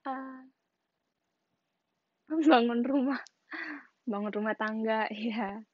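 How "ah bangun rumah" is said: usual speaking voice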